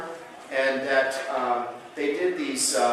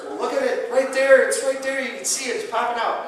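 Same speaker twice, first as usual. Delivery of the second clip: higher, eager, pointing it out; excited patient; 9 semitones higher, 4 dB louder than usual. Retold or imitated. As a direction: play the patient proudly showing off his vein. Patient proudly shows the vein.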